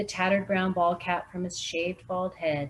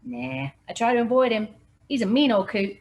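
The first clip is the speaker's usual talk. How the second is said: local man voice; 5 semitones higher, 6 dB louder than usual. Acted. Local Rip voiced by narrator.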